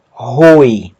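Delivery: "roi" begun with an aspirated h sound; one syllable "roi", h-onset gliding from o to i; rough breathing. Shown as a demonstration. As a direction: say "roi" as "hoi", with a strong h. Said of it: "aspiração forte".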